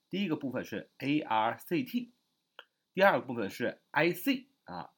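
ordinary delivery

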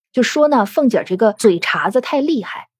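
ordinary delivery

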